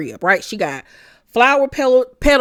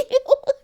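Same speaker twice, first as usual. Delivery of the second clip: mock high giggle. Imitated giggle continues.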